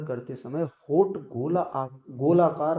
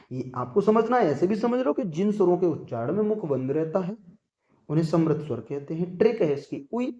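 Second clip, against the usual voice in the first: ordinary talk, nothing marked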